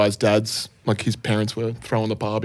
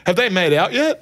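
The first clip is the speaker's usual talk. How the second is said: higher-pitched blurted question; blurted interruption; 6 semitones higher than usual. Retold or imitated. Retelling butting in on a group of people, blurted interruption. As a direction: imitate butting in with an ill-timed question. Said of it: "interrupted people and was like".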